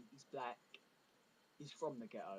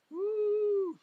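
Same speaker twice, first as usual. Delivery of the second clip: high, loud whoop; excited whoop; 16 dB louder than usual. Acted. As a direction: cheer it like a thrilled fan. Excited cheer about 3D.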